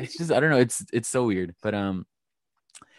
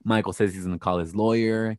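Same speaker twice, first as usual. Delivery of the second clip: ordinary talk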